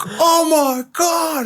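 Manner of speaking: high, drawn-out, slow stunned cry; mock astonished gasp. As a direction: play the whole team gaping at the wedgie. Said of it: "everyone's like".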